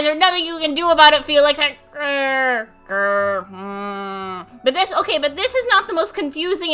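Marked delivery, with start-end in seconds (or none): 0.0-4.6 low playful growling menace; mock growl. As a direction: growl like a grumpy villain. Showing evil eyes expression.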